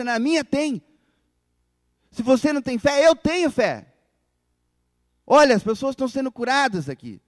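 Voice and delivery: loud, high boastful hype preacher; showy modern preacher. Pretend. Mocking preachers who film miracles for YouTube, loud, high and boastful. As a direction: sell the miracle like a hyped-up showman.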